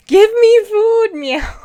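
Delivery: loud, high, slow demanding cat voice; hangry cat voice. Pretend. Streamer voicing her cat begging for food, loud and high. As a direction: whine for food like a hungry cat. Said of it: "That's the".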